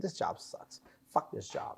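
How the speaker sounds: hushed retort. Retold or imitated. Retells telling off the sergeant.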